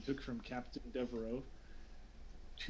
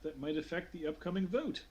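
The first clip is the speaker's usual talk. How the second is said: higher-pitched, hesitant formal request; formal official voice, pitched up; counselor addressing council; 4 semitones higher than usual. Acted. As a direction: play a counselor politely stalling the session. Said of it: "He says".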